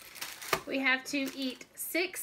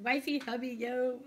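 usual speaking voice

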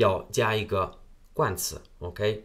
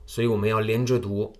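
ordinary talk

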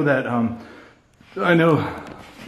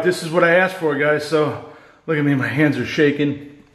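normal talking voice

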